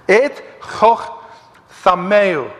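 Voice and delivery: "Et choch" with a throaty Hebrew ch, then "thameu"; slow Hebrew recitation of "Et choch thameu"; Hebrew pronunciation. Shown as a demonstration. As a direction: read the Hebrew carefully, ch from the throat. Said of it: "That's how you would see it in the".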